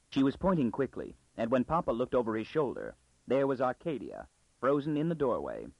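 ordinary delivery

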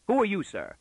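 high, sharp opening that falls away; startled demand. Acted. Father confronting a strange man in his daughter's room, startled. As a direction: challenge the stranger in alarm.

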